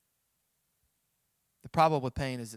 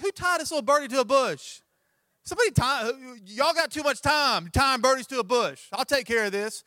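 mock indignant rant; 9 semitones higher, 6 dB louder than usual. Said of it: "I'm like".

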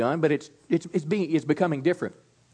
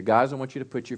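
ordinary delivery

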